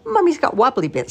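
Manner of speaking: put-on high, loud toddler voice; baby voice. A narrator's reading. The baby pipes up about mummy, high and loud. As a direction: chirp it like a tiny baby.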